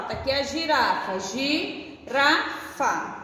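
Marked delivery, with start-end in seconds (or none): 1.2-3.2 slow sounding out. Shown as a demonstration. Teacher to young pupils, sounding out slowly. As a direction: stretch each syllable of Girafa slowly. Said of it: "teacher sounds out word for kids".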